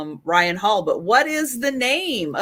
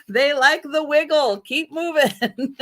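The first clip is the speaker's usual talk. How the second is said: laughing bandmates; 5 semitones higher than usual. Retold or imitated.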